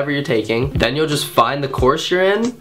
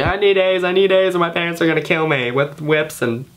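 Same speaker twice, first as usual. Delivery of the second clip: overworked student; 2 semitones higher than usual. Acted. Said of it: "that kid that's in his room twenty-four seven".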